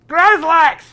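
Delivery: loud, high shout across the room; yelling. Acted. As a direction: bellow the name at full volume. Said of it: "yell at that moment, "Grazlax," really loud".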